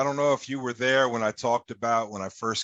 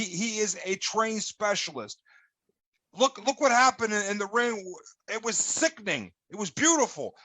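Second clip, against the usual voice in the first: worked-up promo; 8 semitones higher than usual. Acted. Promo rant, pitch keeps climbing.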